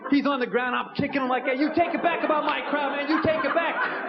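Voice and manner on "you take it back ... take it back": shouted high at a downed man; hammed-up rage, much higher; mock furious demand. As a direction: yell it while kicking him. Kicking him, demanding retraction.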